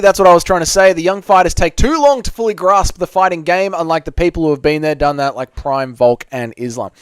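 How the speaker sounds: usual speaking voice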